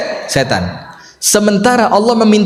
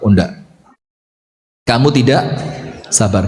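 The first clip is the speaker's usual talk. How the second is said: much lower, calm rebuke; gentle correction; 8 semitones lower, 3 dB quieter than usual. Retold or imitated. Prophet gently telling the woman she was not patient. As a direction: correct her calmly.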